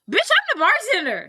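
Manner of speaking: high, mock-indignant outburst; exasperated bartender. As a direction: snap back loudly at an absurd customer. Exasperated bartender snaps back at customer.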